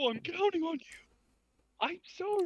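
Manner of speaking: very high, squeaky pleading; mock dramatic plea. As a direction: plead in a tiny high put-on voice.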